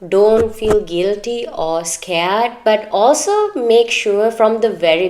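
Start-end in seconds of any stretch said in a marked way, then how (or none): none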